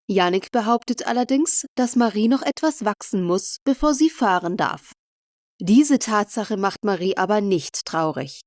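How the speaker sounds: plain reading voice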